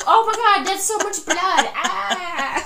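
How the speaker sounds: high, loud fake panic; hammed-up shriek at all the blood; mock horror. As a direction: squeal in pretend alarm, ending in a scream. Acting out fake-blood scene.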